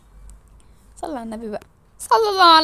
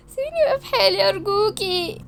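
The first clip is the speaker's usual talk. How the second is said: high, crying, softer voice; tearful plea; 6 semitones higher, 3 dB quieter than usual. Acted. Pleading with friend to leave her alone, tearful. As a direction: beg through tears, pitch way up.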